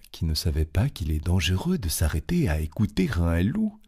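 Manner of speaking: ordinary delivery, nothing marked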